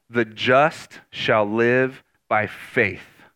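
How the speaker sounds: very slow and weighty; slow, solemn. Real, not acted. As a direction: let each word land. Key verse read slowly.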